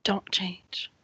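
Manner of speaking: tiny whispered plea. Acted. Emma answers Grudek as he leans close, whispering. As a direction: whisper it, barely voiced.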